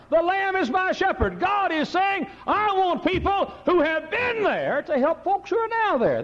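high, ringing pulpit cry; preacher proclaims God's words; full-force preaching. Acted. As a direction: lift it to the rafters.

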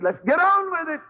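Jesus impatient to get on to the cross; eager resolve. Retold or imitated. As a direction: toss it off like a man eager to get going. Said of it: "I think Jesus' attitude".

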